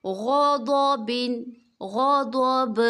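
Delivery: غضبن then غضب, slow, ending in a jolt on ب; slow drill then pause. Shown as a demonstration. Teacher drilling غضبن and its pause form. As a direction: recite غضبن then stop as غضب with a bounce. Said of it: "جھٹکے کی آواز نکالیں گے با میں".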